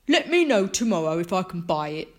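loud, smug villain voice; Mister Bragg. A narrator's reading. Mister Bragg pressing Franklin's mum, smug. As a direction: smirk it out loudly. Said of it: "Mister Bragg smirked".

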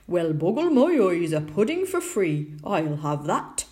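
loud rustic character voice; delighted tinker claiming a free find; hungry tinker. A narrator's reading. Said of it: "said the tinker".

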